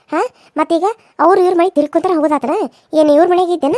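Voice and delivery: loud, indignant interrogation; loud telling-off, some questions rushed; scolding mother-in-law. Acted. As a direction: fire accusing questions at the daughter-in-law. Mother-in-law scolds returning daughter-in-law.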